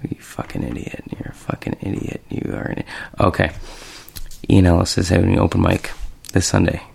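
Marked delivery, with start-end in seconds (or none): 0.1-2.9 hushed self-scolding whisper. Real, not acted. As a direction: whisper it at yourself in disgust. Scolding himself after a mistake.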